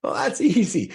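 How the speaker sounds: high mock breezy voice; dismissive woman, mimicked. Woman dismissing his lifelong struggle, mock breezy. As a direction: toss it off high, imitating her. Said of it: "And she goes".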